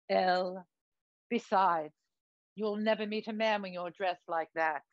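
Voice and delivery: affected British show-business friend; camp, affected British accent; put-on British accent. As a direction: advise grandly in theatrical British. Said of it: "what's with the accent?".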